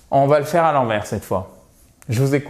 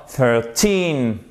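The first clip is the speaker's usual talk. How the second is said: put-on "Thirteen" with a long falling TEEN; stress on TEEN. Shown as a demonstration. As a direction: draw out the last syllable of "Thirteen". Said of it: "c'est la dernière syllabe, le teen, qui est accentué". (accent sur le « teen » long de « Thirteen »)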